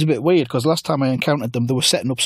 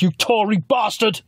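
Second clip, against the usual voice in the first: mock insult; 8 semitones higher, slower than usual. Corbyn insults.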